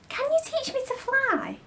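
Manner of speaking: put-on high, soft girl's voice; a little girl pleading to learn; Wendy asking eagerly. Wendy asks Peter.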